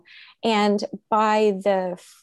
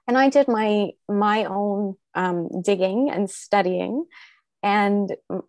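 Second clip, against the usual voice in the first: usual speaking voice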